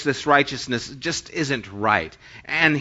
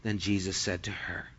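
usual speaking voice

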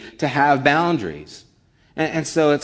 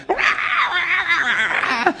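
high mock shrieking mom, much higher; imitating screaming mom; 5 dB louder than usual. Remembering his friend's mom screaming, mock high shriek. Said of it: "she would start screaming".

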